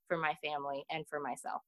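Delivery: trailing into a whisper; soft unvoiced ending. Real, not acted. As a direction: let the last words fall to a whisper. Quiet close of serious point.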